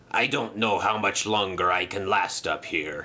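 low, weary groan of a villain. Acted. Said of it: "he groaned".

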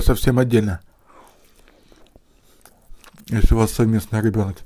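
biting into bread while talking; muffled speech around food; mouth full of bread. Real, not acted. Seen 1: Bread held at lips and bitten while he speaks.

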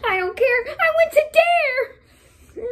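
high, loud wailing outburst; mock desperate yell. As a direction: burst out in a hammed-up high yell. Mock outburst after begging for coins.

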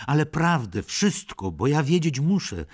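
insist, almost shouting; urgent demand. Acted. Princess demanding the truth.

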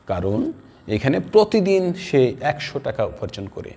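ordinary delivery

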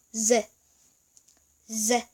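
제 with a buzzing z at the start; 제 said as a foreign z sound; demonstrated letter sound. Shown as a demonstration. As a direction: model 제 as a voiced "ze", each one separate. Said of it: "제 소리가 나고요".

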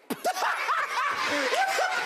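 long, high, helpless giggle; high, helpless fit of giggles; high giggling fit. Real, not acted. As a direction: laugh until it squeaks. Laughing fit after big hair.

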